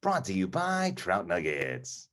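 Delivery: slow, hushed sponsor tag; playful announcer plugging the sponsor; true announcer voice. Pretend. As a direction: draw out a mock sponsor read, high and soft. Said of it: "like, a true announcer voice".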